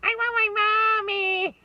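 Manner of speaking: put-on very high, loud, slow little-girl wail; crying child. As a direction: wail it like a spoiled little kid. Spoiled daughter wants her mother.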